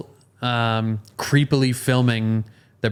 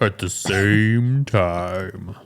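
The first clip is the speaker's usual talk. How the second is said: very slow, savoring each word; drawn out; much slower than usual. Pretend. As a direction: stretch it out with relish. Echoing for emphasis, drawn out.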